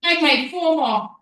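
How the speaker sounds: slow, loud rep count; loud countdown call. Real, not acted. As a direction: push the class through. Counting down the reps.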